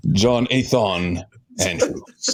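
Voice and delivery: name dragged out slowly; slow, drawn out. Acted. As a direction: draw out each syllable. Agent names himself slowly.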